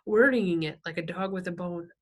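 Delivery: plain reading voice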